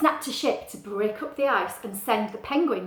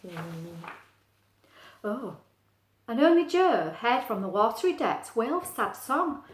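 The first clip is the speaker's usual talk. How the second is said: ordinary delivery, nothing marked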